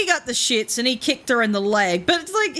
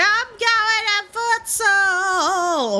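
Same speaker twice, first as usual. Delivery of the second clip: much higher and very slow, "going" dragged out into a whine; whiny kid; 11 semitones higher, much slower than usual. Retold or imitated. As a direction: drag every word out like a sulking boy who won't give up. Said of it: "I don't know how kids whine".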